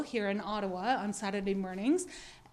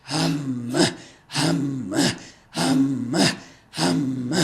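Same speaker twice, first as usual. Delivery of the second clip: deep growling monster hum; 9 semitones lower, 7 dB louder, much slower than usual. Shown as a demonstration. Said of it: "but this time use your monster voice".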